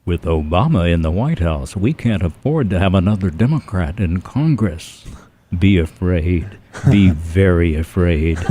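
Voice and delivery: ominous ad voiceover. Shown as a demonstration. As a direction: menace them like an attack ad. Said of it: "political commercials like".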